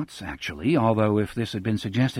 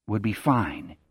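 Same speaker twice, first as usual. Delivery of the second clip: quiet; 4 semitones lower, 5 dB quieter than usual. Acted. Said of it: "Jack says quietly".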